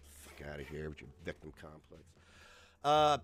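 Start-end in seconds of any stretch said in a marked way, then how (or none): 0.1-2.0 low, very soft aside; dismissive mutter. Real, not acted. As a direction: mutter it under your breath in disgust. Dismissive aside after the bit.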